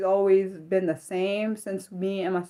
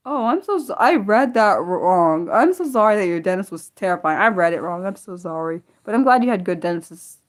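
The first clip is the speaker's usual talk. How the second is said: flustered loud apology; 8 dB louder than usual. Real, not acted. Apologising after misreading.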